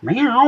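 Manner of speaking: squeaky imitation of a cat; playful high meow; high mock meow. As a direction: meow like a kitten. Meowing back at cat.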